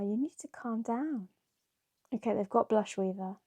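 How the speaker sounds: ordinary delivery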